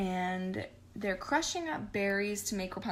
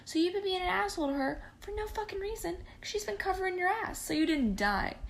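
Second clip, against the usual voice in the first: much higher, fast indignant rant; Penelope scolding dad; 8 semitones higher than usual. Retold or imitated. Penelope tells off her dad, much higher and indignant. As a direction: let it tumble out, fed up.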